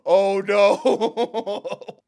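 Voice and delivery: loud, high wail dissolving into laughter; amused, loud lament; laughing dismay. Real, not acted. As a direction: cry out helplessly while laughing.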